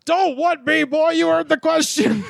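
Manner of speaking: mock furious veteran. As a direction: snap it back like a riled soldier. Retold quarrel, Chris voiced.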